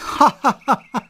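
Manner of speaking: high, loud burst of laughter; mocking laugh. Teasing a dragon with no emotions, mocking laugh. Mocks the other's lack of emotions.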